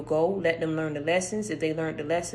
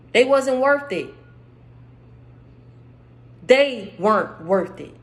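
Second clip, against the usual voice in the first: preaching; 4 semitones higher, 5 dB louder than usual. Acted. Leads a fervent exhortation.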